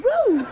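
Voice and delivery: short high "woo"; celebratory whoop. Acted. Husband celebrating a quick shopping trip, high whoop.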